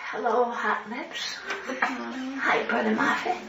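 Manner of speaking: slow, soft, faint greeting; weak dying voice. Retold or imitated. Dying man greeting his visitors, slow and faint. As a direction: barely get it out.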